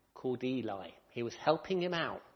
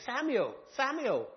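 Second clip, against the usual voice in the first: calling out; 11 semitones higher, 5 dB louder, slower than usual. Acted. Voice calls Samuel again.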